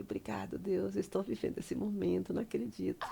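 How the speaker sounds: quiet, grateful aside to God; hushed thanks to God. Retold or imitated. Retold private thanks to God.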